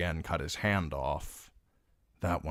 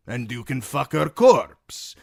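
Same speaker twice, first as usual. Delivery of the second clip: a loud, raised fighter's voice, wary; Dornishman's gruff battle voice; 7 semitones higher, 9 dB louder than usual. Acted. Timion egging on the fool, loud and tense. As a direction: bark it like a wary sellsword.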